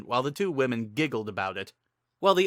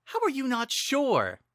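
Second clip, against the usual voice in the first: much higher, exasperated question; exasperated disbelief. Acted. Link pressing Sing in exasperation, much higher. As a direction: demand an answer, incredulous.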